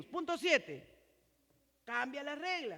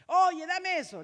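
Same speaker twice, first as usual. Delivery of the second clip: loud, sharp, higher barked order; rude demand; 6 semitones higher, 10 dB louder than usual. Shown as a demonstration. Husband barking an order at his wife, loud and rude. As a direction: snap it like a bossy husband. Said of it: "Qué es lo que Dios te está llamando a cambiar".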